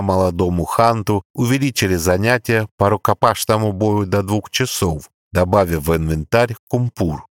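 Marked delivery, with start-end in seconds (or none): none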